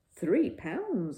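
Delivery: a mild impressed cry; exclaiming. A narrator's reading. Father impressed by Paddington's bargain, loud exclaim. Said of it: "exclaimed Mister Brown".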